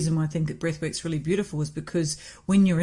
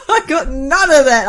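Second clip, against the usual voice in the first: laughing repeat; 8 semitones higher, 10 dB louder than usual. Real, not acted. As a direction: say it again through laughter, high and loud.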